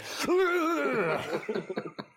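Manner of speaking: high-pitched mock parrot cackle; imitated parrot laughter. Podcaster mocking a puppet parrot made to laugh, squawky fake laughter. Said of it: "Make this parrot look like it's laughing".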